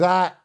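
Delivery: high taunting "ah"; bratty squeal to drown her out; quick mocking yelp; childish mock squeal. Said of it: "I get childish with you".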